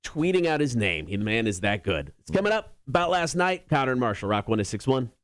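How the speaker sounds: upbeat radio read that drops at the sign-off; radio show tease. Acted. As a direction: tease the next story like a radio host. Radio host teases upcoming stories.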